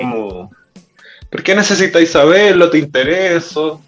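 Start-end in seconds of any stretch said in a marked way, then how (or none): 1.3-3.8 high, ironic put-down; ironic comeback. Retold or imitated. Mocking a curious stranger with a higher ironic comeback. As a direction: tease the nosy asker with irony. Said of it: "tratar de ser un poco más irónico".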